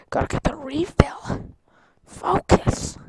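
tense whisper. Real, not acted.

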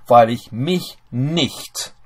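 slow, deliberate reading of the German clause. Shown as a demonstration. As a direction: spell the sentence out word by word, slowly. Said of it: "reads built German clause aloud".

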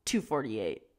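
low, spat-out cashier line. A narrator's reading. Cashier spitting the price at Ravi, low and contemptuous. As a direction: spit the price at him with contempt. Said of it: "the lady spat".

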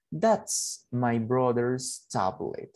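very slow, careful English; teacher modelling English slowly; slow model sentence. Shown as a demonstration. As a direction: dictate it slowly. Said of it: "puedo decir aquí".

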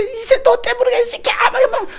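high, loud, blaming shriek; acted-out furious yell; cursing. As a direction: curse the husband through labour pain. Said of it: "신랑한테 욕해".

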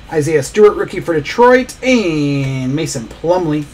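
usual speaking voice

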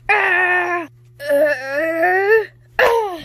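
loud, high yelps of pain; rising shouts of hurt; pained cries. Acted. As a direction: cry out as you get hit. Character screams during the boss fight.

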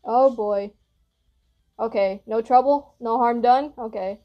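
ordinary talk, nothing marked

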